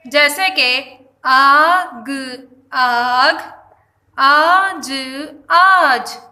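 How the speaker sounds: آگ and آج each said with a long drawn aa; long aa words. Shown as a demonstration. Teacher modelling long aa words for students. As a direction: stretch the opening آ of آگ and آج. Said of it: "یعنی کہ آ".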